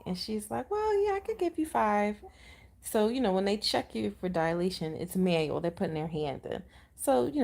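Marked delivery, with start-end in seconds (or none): 0.7-2.2 imitated doctor. Voicing the doctor's noncommittal reply.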